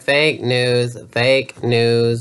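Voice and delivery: normal talking voice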